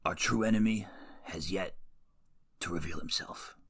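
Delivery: whispered mobster movie quote; hushed, husky gangster impression; Silvio. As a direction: do a bad hushed mob-boss voice. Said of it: "you gotta warm up to Silvio".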